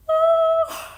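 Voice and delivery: loud, very high squealed oh; swooning squeal. Pretend. Fan swoons over Logan Lerman, squealing high.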